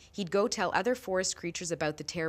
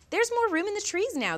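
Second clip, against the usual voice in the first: much higher, light little squirrel voice; 3 dB louder than usual. A narrator's reading. Squirrel talking to the forest creatures, high chirpy voice. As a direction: pipe it up high like a small squirrel. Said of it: "the squirrel said".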